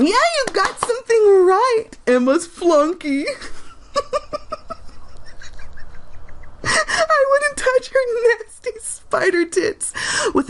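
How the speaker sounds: high, breaking into giggles; can't get it out for laughing; reading a comment while giggling; laughing through it. Real, not acted. Laughing at insult.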